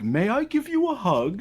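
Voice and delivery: high, polite little request; mock polite Brook. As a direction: ask it sweetly and high. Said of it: "he'd be like".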